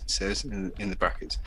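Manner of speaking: usual speaking voice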